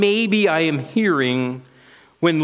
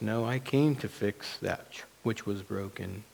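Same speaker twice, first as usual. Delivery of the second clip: low, hushed reassurance; reply very softly, as God mending the broken; God's voice; 6 semitones lower, 11 dB quieter than usual. Acted. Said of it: "And God's voice says".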